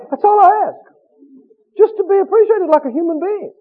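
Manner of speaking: high, loud self-pitying whine; mock the worker who begs for praise; whiny complaining voice. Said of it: "You've ever heard somebody say".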